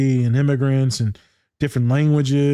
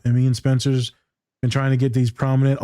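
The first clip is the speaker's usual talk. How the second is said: ordinary talk, nothing marked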